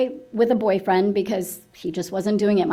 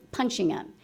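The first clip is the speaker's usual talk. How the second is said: usual speaking voice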